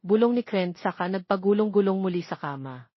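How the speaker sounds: ordinary delivery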